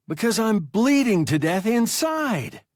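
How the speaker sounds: high, loud accusing whine; hurt young pilot blaming his captain, high and loud; accusing boy. A narrator's reading. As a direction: throw the blame in a high voice.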